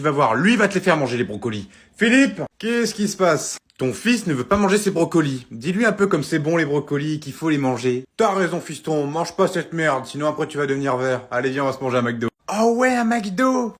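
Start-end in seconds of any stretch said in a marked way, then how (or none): 2.0-3.6 high, loud, slow call. Acted. Mum calls Philippe about the broccoli, loud. 12.5-13.7 high, slow delighted cry; thrilled. Acted. Son is thrilled about going to McDo.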